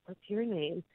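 soft re-enacted whispered question; hushed, secretive question. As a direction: ask it very softly, as if into an ear. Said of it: "I whispered in his ear".

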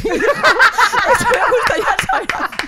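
hammed-up crying wail. Mother retelling how she cried to her son, hammed-up wail. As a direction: overplay a sobbing mother, cracking up. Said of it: "me puse a llorar también y yo".

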